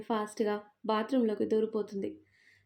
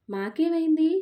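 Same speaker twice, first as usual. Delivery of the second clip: indignant question; 6 semitones higher, 5 dB louder than usual. A narrator's reading. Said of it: "కోరస్గా అడుగుతారు".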